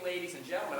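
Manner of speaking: range brought up. Shown as a demonstration. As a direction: raise the range quickly on the address. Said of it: "I brought the range up pretty quick".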